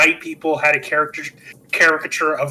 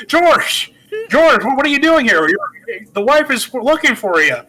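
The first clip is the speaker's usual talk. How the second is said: high, stammering, excited movie-star voice, halting and loud; stammering old-movie hero; 7 semitones higher, 3 dB louder than usual. Retold or imitated. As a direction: trip over the words like a flustered classic film hero. George retold, stammering at the cop.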